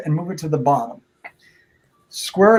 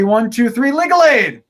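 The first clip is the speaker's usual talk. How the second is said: put-on kids party magician; 5 semitones higher, 5 dB louder than usual. Countdown chant of magic word.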